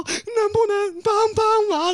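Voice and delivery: pleading. Retold or imitated. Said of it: "我就说".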